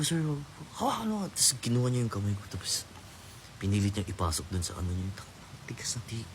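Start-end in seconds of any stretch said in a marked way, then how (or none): 0.0-1.2 high, pleading retold quarrel; mock pleading. Retold struggle, both voices quoted. 5.2-6.3 breathy, slow, hushed confession; whisper. Real, not acted. Secretive naughty aside.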